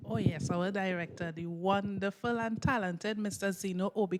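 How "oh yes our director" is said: ordinary delivery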